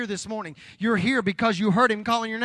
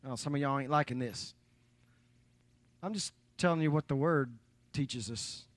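teasing aside; 8 semitones lower, 9 dB quieter than usual. Real, not acted.

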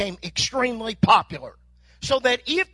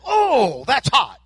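loud startled "oh, that's hot"; pained yelp; 5 dB louder, slower than usual. Acted. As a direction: yelp as the hot pipe burns your hand. Buyer grabs hot tailpipe.

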